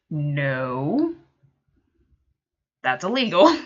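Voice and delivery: a firm, emphatic no, voice rising on illegal; firm refusal. Retold or imitated. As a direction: make it very clear it's illegal. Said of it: "Made that very clear".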